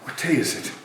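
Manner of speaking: normal talking voice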